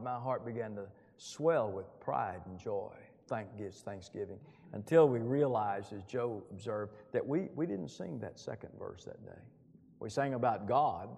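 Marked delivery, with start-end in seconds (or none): none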